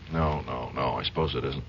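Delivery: deflated concession. Acted. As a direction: let it sink into a quiet, defeated admission.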